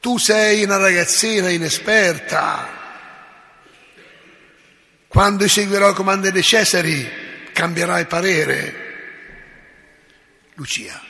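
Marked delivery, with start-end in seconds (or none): 0.0-8.8 the prefect's louder, shouted threat; raised voice. Acted. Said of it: "alza la voce e le gridò". (il prefetto alza la voce e grida a Lucia)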